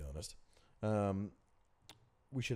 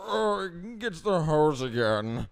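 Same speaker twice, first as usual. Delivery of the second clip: high, loud, slow drawling Jimmy Stewart take on the creepy line; Jimmy Stewart doing Buffalo Bill; 11 semitones higher, 11 dB louder, much slower than usual. Retold or imitated. Doing Jimmy Stewart as Buffalo Bill for podcast friends. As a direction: stretch it slow and high like an old film star. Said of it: "that was Jimmy Stewart doing Buffalo Bill".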